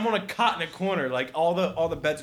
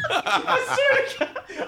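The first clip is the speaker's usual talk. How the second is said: breaking into high laughter, slowly; can barely get it out for laughing; real laughter through the words; slower than usual. Real, not acted. Cracking up mid story.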